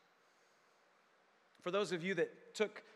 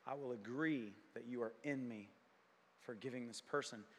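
quiet prayer; 8 semitones lower, 7 dB quieter than usual. Retold or imitated. Preacher praying to forgive someone, softly and humbly. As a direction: pray it humbly under your breath.

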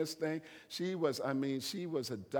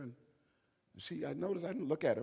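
ordinary talk

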